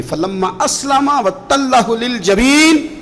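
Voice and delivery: slow, drawn-out melodic recitation; measured Quranic recitation, stretched vowels. Acted. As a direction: chant the verse slowly. Quranic verse recited in chant.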